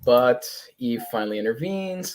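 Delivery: normal talking voice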